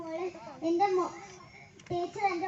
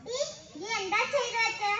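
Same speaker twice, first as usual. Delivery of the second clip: ordinary talk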